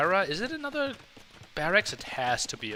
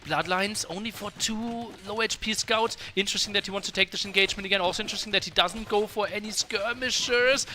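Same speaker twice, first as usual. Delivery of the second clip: usual speaking voice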